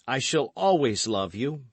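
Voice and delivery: loud, high assurance of love; gallant young man. A narrator's reading. D'Artagnan returns the vow.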